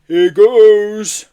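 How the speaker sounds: loud, high, drawn-out yeti voice; gleeful heave. A narrator's reading. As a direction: wind up for a big throw. Yeti heaving the boy upward.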